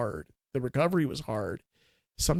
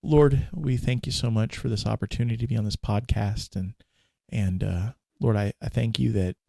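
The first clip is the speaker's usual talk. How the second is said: earnest, slightly louder prayer voice; speaking to God in prayer; 3 semitones lower, 3 dB louder than usual. Acted. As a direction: open a prayer for listeners. Closing prayer.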